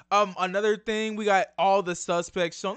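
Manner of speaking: usual speaking voice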